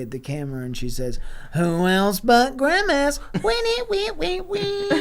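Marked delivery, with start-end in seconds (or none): 1.5-4.9 slow line climbing into high squeaky wees; hammy catchphrase. Acted. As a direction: draw it out higher and higher like a sitcom tag. Mom's catchphrase to camera, with jingle.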